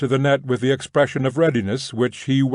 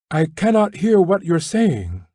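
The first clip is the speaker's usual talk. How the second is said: raised and higher, calling across the noise; 6 semitones higher than usual. A narrator's reading. Woman calling through the prison net, raised higher. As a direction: call over the crowd, a bit higher. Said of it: "she called out".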